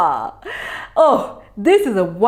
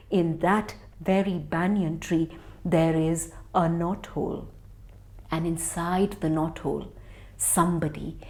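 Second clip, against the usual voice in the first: low, soft, secretive telling; quiet spooky storytelling; suspenseful hush; 6 semitones lower, 6 dB quieter than usual. A narrator's reading. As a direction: hush the children for the hidden danger. Snake hidden in knothole.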